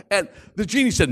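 usual speaking voice

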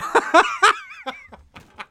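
real laugh breaking into a high shriek; high squealing laugh. Real, not acted. Comedian cracking up at his own joke, high squealing laugh.

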